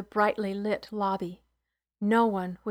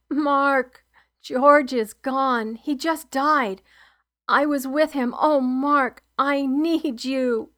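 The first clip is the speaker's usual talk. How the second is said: louder, slow, anguished cry; 4 semitones higher, 6 dB louder, slower than usual. Acted. On the phone to Mark after George dies, sobbing cry. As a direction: call out in grief, voice breaking. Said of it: "and then I cried out".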